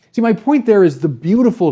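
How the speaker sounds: usual speaking voice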